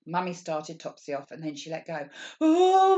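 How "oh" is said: much higher, louder startled cry; frightened oh. Acted. Topsy startled when Mummy lets go, high loud yelp. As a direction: yelp as the bike wobbles. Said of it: "went Topsy".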